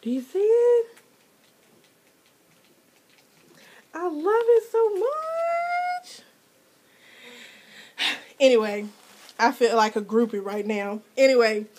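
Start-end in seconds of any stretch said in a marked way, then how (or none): 0.0-6.2 gushing squeal. Real, not acted. Vlogger gushes to viewers about a handmade gift. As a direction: swoon over the gift.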